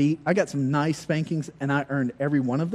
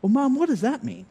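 put-on higher, puzzled little boy; kid voice; 9 semitones higher than usual. Son asking his mother, lightly voiced child. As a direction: ask it like a small boy.

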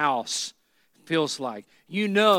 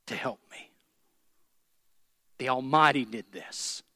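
whisper; 5 semitones lower, 3 dB quieter than usual. Acted. Preacher voicing Naomi's grief, whispered. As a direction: breathe the words out in a bare whisper.

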